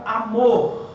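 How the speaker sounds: amor slowly, weight on the last syllable; stress on MOR. Shown as a demonstration. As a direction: lean on a long MOR in amor. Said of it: "A sílaba mais forte é a última sílaba".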